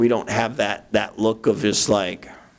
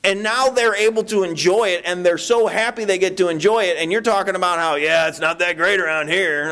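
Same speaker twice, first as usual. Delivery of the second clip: high, exasperated inner rant; scolding himself, voice pitched way up; mock indignant; 7 semitones higher than usual. Preacher replaying his inner rebuke, high and exasperated. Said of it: "I'm like".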